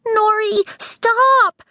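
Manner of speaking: high loud pleading cry; Pepper begging. A narrator's reading. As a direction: beg her to stop. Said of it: "Pepper begged".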